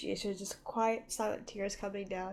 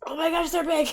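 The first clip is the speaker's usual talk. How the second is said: excited gasp; 8 semitones higher, 9 dB louder than usual. Real, not acted. Reacting to the episode.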